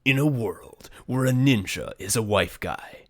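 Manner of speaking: slow, dramatic trailer announcer; movie trailer voice. Acted. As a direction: narrate it like a film trailer. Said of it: "I wrote a tagline like, like the trailer, movie trailer voice guy would say".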